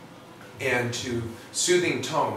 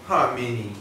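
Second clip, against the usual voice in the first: put-on dog baby talk; 3 semitones lower than usual. Shown as a demonstration. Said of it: "do the exam down here".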